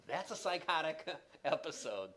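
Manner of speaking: amused. Real, not acted. Lecturer reacting to a patient's bizarre ICU story, amused. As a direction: laugh partway through the phrase.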